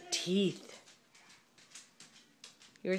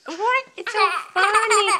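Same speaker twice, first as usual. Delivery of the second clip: laughing; 11 semitones higher, 8 dB louder than usual. Real, not acted. Parent laughing with child.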